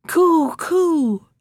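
high birdlike coo; pigeon cooing. Acted. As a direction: coo it like a bird. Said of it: "said the wood pigeons".

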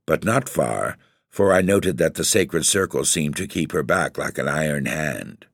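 plain reading voice

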